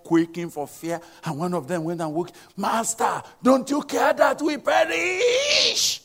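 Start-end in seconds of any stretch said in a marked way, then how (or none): 2.6-6.0 high, loud, slow cry of panic; frightened disciples yelling at the sleeping master; panicked cry. Acted. Terrified disciples shouting to wake their master.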